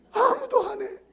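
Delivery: high mock wail; fake-sob it to the crowd; hammed-up crying complaint.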